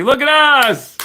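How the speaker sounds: high, loud and thrilled; excited cheer. Real, not acted. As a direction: shout it out in delight at the total. Celebrating donation total live.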